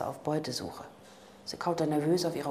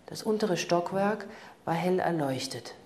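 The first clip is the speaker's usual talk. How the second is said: ordinary delivery, nothing marked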